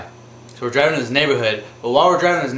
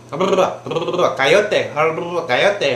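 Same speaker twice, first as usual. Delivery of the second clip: fast rolled-r gibberish in a mock Spanish accent, higher pitch; imitated Spanish chatter; 5 semitones higher than usual. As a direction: imitate coworkers jabbering in Spanish. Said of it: "they're sitting there talking amongst themselves".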